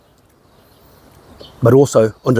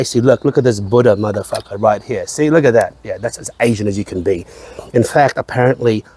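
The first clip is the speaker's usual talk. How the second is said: usual speaking voice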